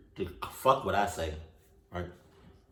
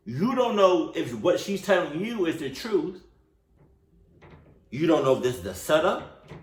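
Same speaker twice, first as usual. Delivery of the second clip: high-pitched, indignant lecturing; pissed; 7 semitones higher than usual. Real, not acted. Viewer lecturing the story's hero about trusting her, pissed. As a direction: scold in a raised pitch, driving each point home. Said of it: "this is why I'm pissed".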